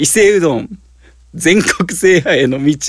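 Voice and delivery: words breaking into giggles; laughing while reading. Real, not acted. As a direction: read through helpless laughter. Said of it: "笑いすぎて話入ってこうへんわ".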